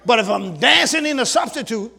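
building pulpit shout; preaching at full force. Acted. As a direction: call the church to attention with fire. Pastor preaching on spiritual blessing.

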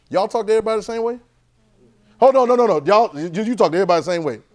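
loud, high, rousing preaching; energetic sermon push; preaching at full force. Acted. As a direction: call out to the congregation, fired up. Preacher presses congregation on speech.

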